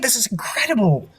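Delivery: lightly voiced high girlish tinkerer, enthralled; excited tiefling. Tiefling inspecting the sword, lightly voiced high excitement. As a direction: gush over the blade. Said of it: "she goes".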